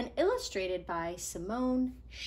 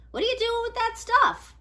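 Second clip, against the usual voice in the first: high, bright-voiced girl Iris; a new neighbour girl, curious; 13 semitones higher, 7 dB louder than usual. Iris asking Nico about his pencils, high and curious.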